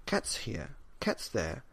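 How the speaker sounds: ordinary delivery, nothing marked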